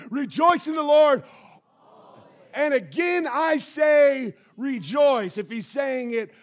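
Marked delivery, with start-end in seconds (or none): 0.1-5.4 loud, high chant for the church to repeat; call and response shout. Shown as a demonstration. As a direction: lead the room in saying it, full voice. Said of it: "Can you say it with me?".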